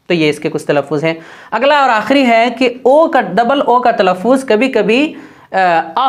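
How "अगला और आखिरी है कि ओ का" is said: ordinary talk, nothing marked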